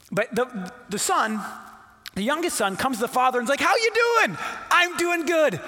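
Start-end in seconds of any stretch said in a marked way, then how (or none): 3.6-5.6 mock cheery greeting. Son greets father, acted exchange.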